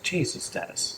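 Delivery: a voiceless, exasperated whisper; whispered plea. Retold or imitated. Man whispering in exasperation at his blunt sponsor. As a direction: breathe it out in disbelief.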